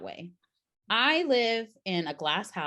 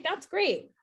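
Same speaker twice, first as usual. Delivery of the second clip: mock cheer; 12 semitones higher than usual. Quoting friend's sarcastic agreement.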